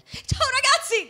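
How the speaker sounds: very high, slow, bubbly TV host voice; Disney Channel girl. Retold or imitated. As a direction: chirp it like a kids' TV presenter. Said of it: "sembravo veramente la tipa de-, di Disney Channel".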